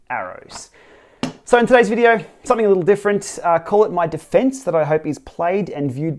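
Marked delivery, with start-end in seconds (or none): none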